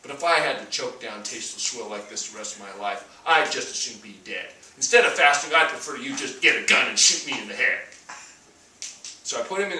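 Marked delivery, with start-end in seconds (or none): 0.0-7.8 lightly voiced grumbling old patient, louder at the end. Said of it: "And he said".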